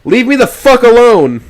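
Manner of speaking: high, loud, slow desperate yell; screaming. A narrator's reading. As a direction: scream it in panic. Narrator screams at ghost.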